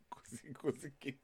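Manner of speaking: unvoiced-sounding hushed aside; whisper. Real, not acted. Muttering to friends after teasing, whispered. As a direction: drop right down into a whisper.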